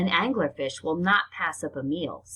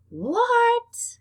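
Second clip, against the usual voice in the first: a much higher, louder shocked what; 5 dB louder than usual. Real, not acted. Reader learning the male bites on for life, shocked squeal. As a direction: squeal it in disbelief.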